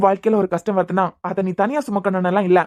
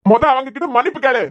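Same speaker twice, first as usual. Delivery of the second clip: stern demand; 4 semitones higher than usual. Acted. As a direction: demand the apology firmly. Uncle demands an apology. (மன்னிப்பு கேட்கச் சொல்லி கண்டிப்பான உரத்த குரலில்)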